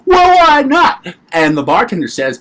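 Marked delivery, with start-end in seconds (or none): 0.1-1.1 high-pitched, drawn-out comic protest; indignant bird cages. Acted. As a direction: whine back way up high, slowly.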